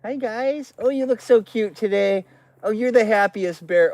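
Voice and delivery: high-pitched cooing; doting pet talk. Real, not acted. Man greeting his bears, high doting coo. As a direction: fuss over them like adored pets.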